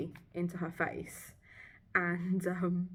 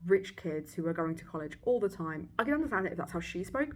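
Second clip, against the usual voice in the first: ordinary delivery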